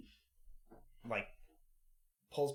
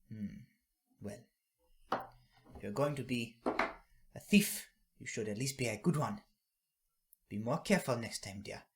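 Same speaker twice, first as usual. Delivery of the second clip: fond granny advice; 5 dB louder than usual. Acted. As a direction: gently advise the boy.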